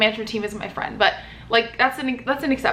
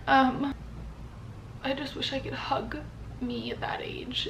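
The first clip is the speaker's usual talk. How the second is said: tearful whisper, much softer; slow, breaking whisper of longing; crying; 4 semitones higher, 8 dB quieter than usual. Real, not acted. Wishing to hug her younger self, tearful whisper. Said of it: "I'm crying".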